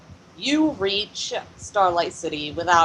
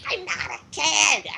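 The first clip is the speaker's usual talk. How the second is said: indignant yell; 12 semitones higher than usual. Acted. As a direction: yell it in character, much higher than usual. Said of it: "C B yells".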